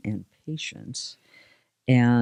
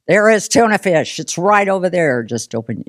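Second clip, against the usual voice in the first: louder, higher, fast mock exasperation; exasperated; 4 semitones higher, 5 dB louder than usual. Guest voicing her frustration about the tuna fish. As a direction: rattle it off in frustration. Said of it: "And it's like".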